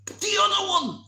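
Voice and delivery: high yelled correction; shouting. Retold or imitated. Trainer yelling which arm to put out, high shout. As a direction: call it out loud and high. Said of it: "he's shouting at me".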